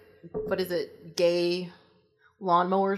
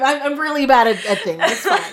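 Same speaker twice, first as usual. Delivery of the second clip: ordinary talk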